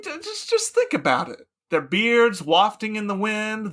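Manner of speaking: loud, leering philosopher; eager Socrates. Acted. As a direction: sell it with hungry enthusiasm. Socrates pushing male-only games.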